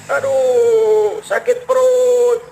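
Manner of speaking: slow, drawn-out moan over a sore belly; groaning in pain. Acted. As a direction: moan it like a man doubled up with stomach ache. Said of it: "Dengar orang sedang mengerang, mengeluh, merintih".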